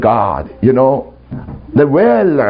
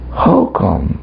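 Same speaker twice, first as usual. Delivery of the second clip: lower, unruffled retold voice of God; calm; 6 semitones lower than usual. God questioning the preacher about the devil, calm low voice. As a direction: ask it calmly, pitched down. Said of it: "He just calmly said".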